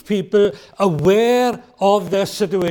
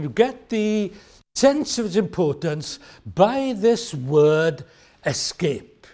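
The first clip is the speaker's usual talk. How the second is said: usual speaking voice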